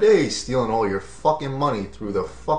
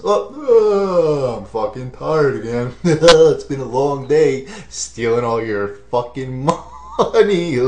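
tired complaint breaking into real laughs; slow, loud, giggling mock groan; laughing through it; 5 dB louder, slower than usual. Real, not acted. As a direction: try to stay in the voice but crack up. Voicing lifter, cracking up.

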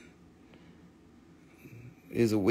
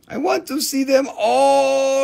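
put-on high, slow, wide-eyed little kid voice; childlike voice; 9 semitones higher, 4 dB louder than usual. Seller voices an eager buyer, high and slow like a kid. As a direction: play a greedy child who wants everything.

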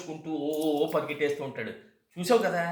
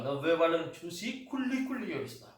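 ordinary talk, nothing marked

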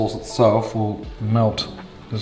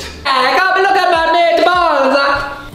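high, slow, laughing call; squealing come-look call; excited call; 3 dB louder, slower than usual. Real, not acted. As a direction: call a mate over to show off. Calling someone to see food.

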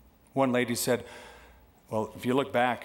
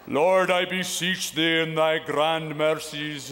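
pompous prayer; slower than usual. Pretend. Mock long formal prayer.

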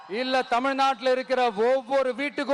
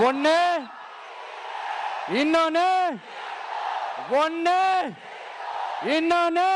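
climbing higher each time, ending in a shout; building emphasis; 4 semitones higher than usual. Real, not acted. Leader counting the two rivals for the crowd, building to a shout.

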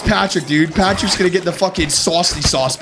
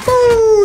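sharp high loud whoop; victory woo; 14 semitones higher, 6 dB louder than usual. Real, not acted. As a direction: let out a triumphant woo. Killing an enemy.

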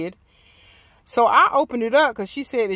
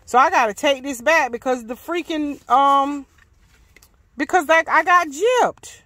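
loud, high indignant rant; real frustration, louder and higher; annoyed; 5 semitones higher, 8 dB louder than usual. Real, not acted. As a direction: fume at the missing numbers. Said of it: "I am so annoyed".

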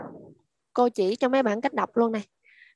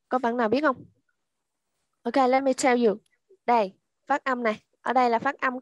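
ordinary talk

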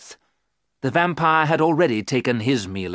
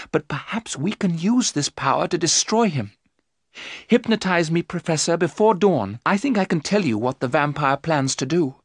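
high, trembling Mina; frightened young wife; 6 semitones higher than usual. Acted. Mina telling the men of her terror, high-voiced. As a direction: recount the nightmare, much higher.

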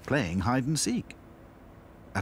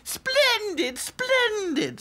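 high haughty doll voice; snooty little princess; 6 dB louder, much slower than usual. A narrator's reading. Princess dolls.

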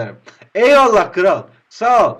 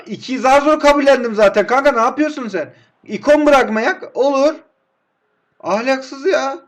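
higher, louder frustrated rant; louder exasperated complaint at the game; exasperated outburst; 8 semitones higher, 6 dB louder than usual. Real, not acted. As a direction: vent at the screen. Angry at the game.

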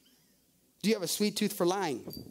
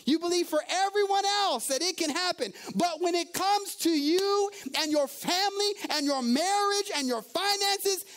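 rising from higher voice to a shout; preaching, building; 8 semitones higher than usual. Acted. Preacher pressing the congregation on faith, building to a shout. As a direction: build up and hit finances hard.

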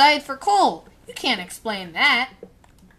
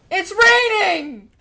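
high, stretched-out cry; excited exclamation; 6 semitones higher, 3 dB louder, slower than usual. Real, not acted. Player exclaiming at rain in the game, excited. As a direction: call it out high and slow, drawing out the word.

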